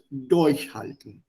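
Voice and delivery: slow Durchhalten, weight on DURCH; teacher's slow demo of "Durchhalten", first syllable stressed. Shown as a demonstration. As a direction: lean hard on "Durch" in "Durchhalten", slowly. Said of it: "stress on the first syllable".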